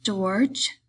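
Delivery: a timid, questioning call. A narrator's reading. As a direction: ask for his attention timidly. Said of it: "Lenny looked timidly over to him".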